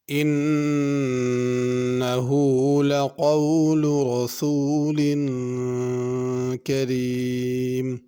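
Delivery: slow, drawn-out chanted recitation; measured melodic tilawat; Quran recitation. Shown as a demonstration. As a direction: recite it like the teacher modelling tajweed. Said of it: "teacher recites verse to model tajweed".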